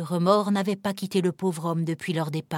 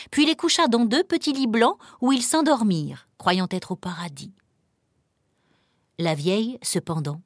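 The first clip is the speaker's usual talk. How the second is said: ordinary delivery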